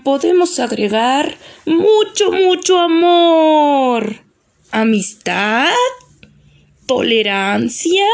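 slow, drawn-out ogre voice; ogre listing sweet words. Acted. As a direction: savour each item slowly, stretching the vowels. Ogre lists nice words for potion. (ogro cariñoso, palabras lentas y alargadas)

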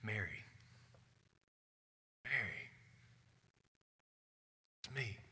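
barely above a whisper, gently; Jesus to grieving Mary, soft and whispered. A narrator's reading. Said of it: "and he just whispers".

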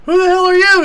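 very high, loud mock yell; outraged challenge. Villain challenges bearded lady, retold.

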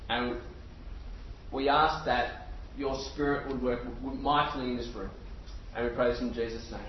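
low, soft, measured praying voice; hushed and reverent, with long pauses; prayer. Real, not acted. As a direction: lead the room in a quiet, unhurried prayer. Leads the room in prayer.

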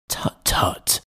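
soft breathy tut-tut; whispered tut. A narrator's reading. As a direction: hush it under your breath.

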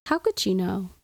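ordinary delivery, nothing marked

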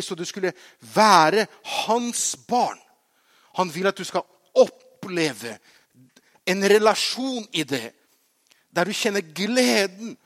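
loud, high, building preacher's voice; fervent preaching. Real, not acted. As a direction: build to a loud, exultant proclamation. Preaches at full force.

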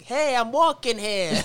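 drawn-out, high New York call; put-on New York accent. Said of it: "was walking around New York".

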